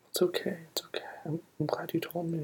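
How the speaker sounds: usual speaking voice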